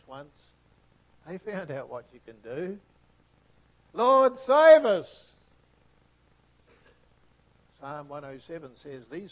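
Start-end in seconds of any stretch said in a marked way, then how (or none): 3.9-5.3 loud, high, slow plea; drawn-out cry of a frightened sailor; desperate cry for rescue. Acted. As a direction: shout it to God from the boat. Acting disciples' cry in storm.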